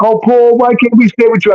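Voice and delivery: put-on high-pitched child's plea; pleading kids. Kids begging Paul to let them stay, pleading. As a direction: imitate children begging, pitch lifted throughout. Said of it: "And the kids love me. They're like".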